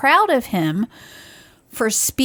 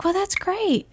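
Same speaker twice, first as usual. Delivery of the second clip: light high voice; a cheerful lifted "great"; bright reply; 10 semitones higher, 4 dB quieter than usual. Retold or imitated. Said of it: "she's like".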